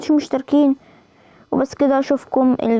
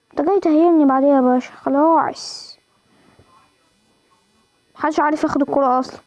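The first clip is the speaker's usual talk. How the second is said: ordinary delivery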